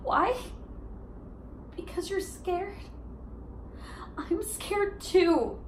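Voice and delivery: high, small, hesitant voice; vulnerable confession. Acted. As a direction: softly confess fear, with long pauses. Monologue, admitting shared fear.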